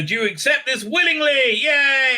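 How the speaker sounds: high, loud, hammed-up officiant; gushing priest voice ending in a shout; mock ceremony cheer. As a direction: whoop the last word like a game-show host.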